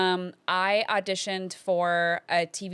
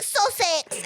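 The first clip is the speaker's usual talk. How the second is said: put-on British accent; 10 semitones higher than usual. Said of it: "my British accent fucking ate".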